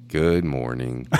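low, slow, glum reply; gloomy mumble. Retold or imitated. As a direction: drag it out like Eeyore. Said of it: "And I'm like".

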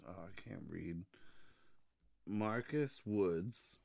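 normal talking voice

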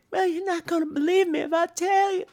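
very high, breathy falsetto giggle. Retold or imitated. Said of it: "sounds like [name]".

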